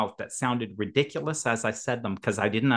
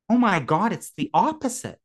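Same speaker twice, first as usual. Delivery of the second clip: excited realization; 9 semitones higher than usual. Retold or imitated.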